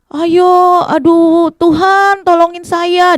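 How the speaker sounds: loud, high, drawn-out cry; desperate calling out. Acted. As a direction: shout for help without giving up. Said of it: "tidak menyerah adik-adik. Dia terus berkata". (teriakan panjang minta tolong)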